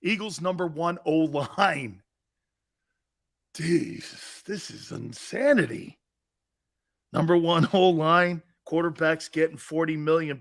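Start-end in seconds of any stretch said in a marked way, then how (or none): none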